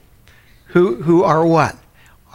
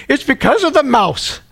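put-on much higher, matter-of-fact little old lady; old lady imitation; 12 semitones higher than usual. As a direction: explain it like a prim grandma, pitched way up. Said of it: "She goes".

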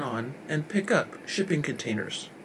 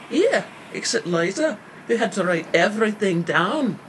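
loud, higher-pitched dig; dissing; 5 semitones higher, 6 dB louder than usual. A narrator's reading. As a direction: diss a coworker out loud. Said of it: "Pete's partner disses".

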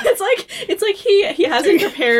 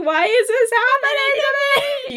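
high-pitched, hammed-up wail; mock sobbing. Friend retelling Anna learning her parents died, mock sobbing. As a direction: exaggerate a tearful, high cry. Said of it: "she just sobs".

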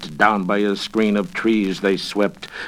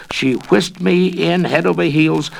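ordinary delivery, nothing marked

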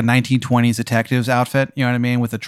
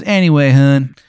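put-on terrible Maryland accent; 3 semitones higher, 6 dB louder than usual. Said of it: "His accent is so bad".

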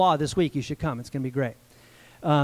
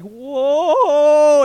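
loud high Whoa from the gut; gut cry of woe; 8 semitones higher, 8 dB louder than usual. Shown as a demonstration. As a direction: let Whoa burst out in despair. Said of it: "expression that wells up from your gut".

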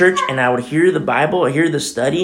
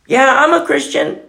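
glib claim; 13 semitones higher, 6 dB louder than usual. Retold or imitated. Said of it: "rolling their papers".

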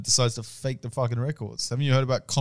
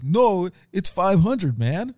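drawn-out, higher American dude; American accent; 5 semitones higher, much slower than usual. Retold or imitated. Retelling the driver's haggling, in a mock American accent. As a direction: put on an American accent, stretching it out slowly. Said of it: "Why is he American in this?".

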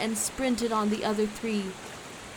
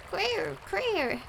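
high, squawked bird cry; high two-note bird squawk; bird call; 9 semitones higher, 3 dB quieter than usual. A narrator's reading. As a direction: imitate a bird calling. Bird calls to its companion.

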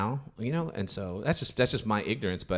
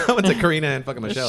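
usual speaking voice

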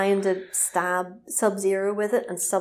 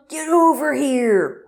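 loud, high, drawn-out roar; long mock-heroic shout; Scorpion battle cry; 6 semitones higher, 5 dB louder, slower than usual. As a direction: bellow it like a fighting-game catchphrase. Scorpion's catchphrase acted out.

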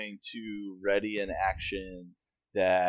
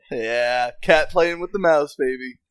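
louder and higher, gleeful; 3 semitones higher, 8 dB louder than usual. Real, not acted. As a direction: crow it out with delight. Repeats own line gleefully.

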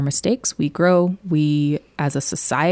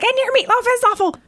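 put-on much higher, quick bratty child voice; little Sarah; faster than usual. Little Sarah insulting her parents' meatloaf, high quick bratty voice. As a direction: squeak it out like a cheeky eight-year-old. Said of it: "little Sarah sitting there being like".

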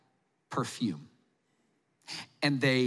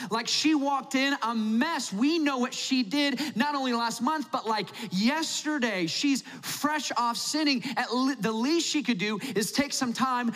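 self-righteous complaint; 6 semitones higher than usual. Retold or imitated. Pharisee Simon griping to Jesus about the woman, mock outrage. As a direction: gossip about the sinner, hammed-up. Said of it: "he said to himself".

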